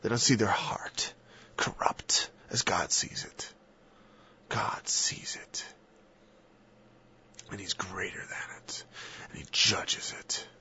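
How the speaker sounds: hushed grave whisper. Real, not acted. Preacher warning of God's judgment, whispered. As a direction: drop to a solemn whisper.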